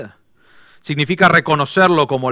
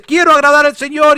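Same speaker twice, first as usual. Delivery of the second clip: vow at its peak; 12 semitones higher, 8 dB louder than usual. Acted. Repeated vow, building each day.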